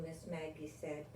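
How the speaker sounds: usual speaking voice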